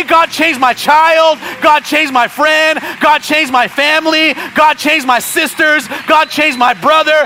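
mild mock pleading; wrong prayers. Shown as a demonstration. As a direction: plead slowly for everyone else to change. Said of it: "I can't pray".

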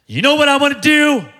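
put-on high, eager kid's question; excited young son. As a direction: imitate a son bursting to share his plan. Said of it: "That's the way he talks".

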